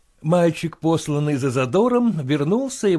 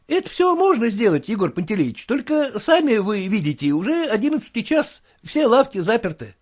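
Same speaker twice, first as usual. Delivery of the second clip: much higher, fussy clerk's voice; clerk reporting; 8 semitones higher than usual. Acted. The clerk tells his master about the drunk neighbour, fussy.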